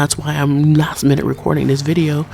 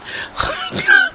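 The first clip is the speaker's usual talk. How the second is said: squealing laugh. Real, not acted.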